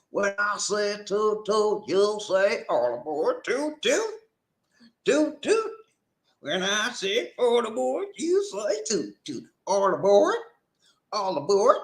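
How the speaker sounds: high-pitched train call-and-response chant; put-on accent. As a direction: lead the crowd in a high, singsong chant. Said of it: "I put on a different accent".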